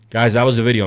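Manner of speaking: usual speaking voice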